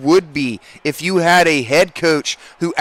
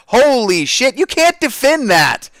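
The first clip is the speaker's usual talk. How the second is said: amazed; 9 semitones higher, 3 dB louder than usual. Real, not acted. Amazed at a perfect play.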